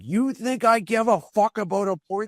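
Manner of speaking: high, loud scornful shot; angry retort. Retold or imitated. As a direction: fire it back in disbelief.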